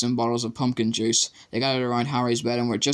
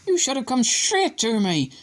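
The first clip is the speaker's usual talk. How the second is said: high, scolding Madam Pomfrey voice; lightly voiced stern matron scolding; raging nurse; 13 semitones higher than usual. A narrator's reading. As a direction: tell him off, furious. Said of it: "she raged".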